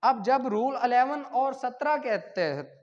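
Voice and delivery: normal talking voice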